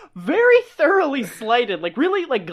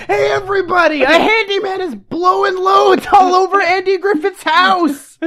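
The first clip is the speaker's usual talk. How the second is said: raised, high town-crier voice; mock announcement; 5 semitones higher, 4 dB louder than usual. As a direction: proclaim the scandal for all to hear. Acted broadcast to the whole town.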